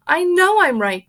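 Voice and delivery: high, loud insistent mother; shrill mother. Acted. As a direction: insist hotly. Mrs Gloop insists.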